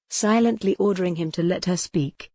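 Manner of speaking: ordinary delivery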